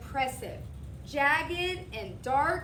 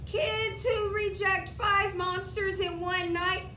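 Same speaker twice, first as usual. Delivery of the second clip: very slow, higher, louder ominous warning; 7 semitones higher, 5 dB louder than usual. A narrator's reading. Mac warns the boy not to send him away. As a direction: lean in with a threat.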